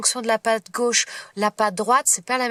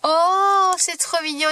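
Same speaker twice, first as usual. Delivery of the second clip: delighted; 7 semitones higher, 5 dB louder, slower than usual. Real, not acted. Opening a gift, delighted.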